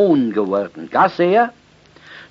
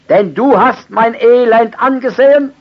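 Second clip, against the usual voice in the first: preaching at full force; 10 semitones higher, 10 dB louder than usual. Acted. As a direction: cry the verse out to the congregation. Preacher proclaims verse.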